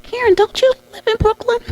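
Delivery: very high, faux-innocent voice; high sing-song mock innocence; innocent leading question. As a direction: play dumb while setting the trap. Voicing colleagues' leading question.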